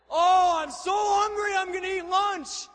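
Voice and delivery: high, very loud hungry shout; roaring battle cry. Retold or imitated. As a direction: bellow it like a crowd's war cry. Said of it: "That was the cry".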